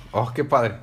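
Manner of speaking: normal talking voice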